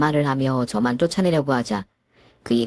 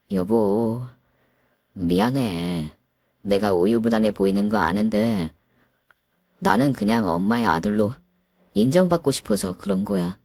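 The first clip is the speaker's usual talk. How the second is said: apologetic husband; 7 semitones lower than usual. Acted. Husband apologizes to his wife, low and meek. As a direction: apologize meekly.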